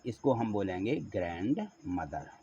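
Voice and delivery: ordinary talk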